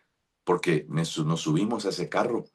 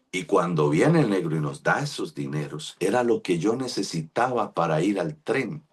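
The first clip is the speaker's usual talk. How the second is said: ordinary delivery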